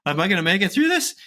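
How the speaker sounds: a touch of pretend panic mid-workout; mock winded panic. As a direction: play it hammed-up, out of breath and desperate. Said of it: "you're winded going".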